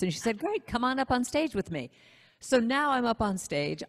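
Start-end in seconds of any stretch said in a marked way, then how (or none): 0.4-1.9 higher-pitched voice for the retold host; inviting host. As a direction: imitate a host calling someone onstage.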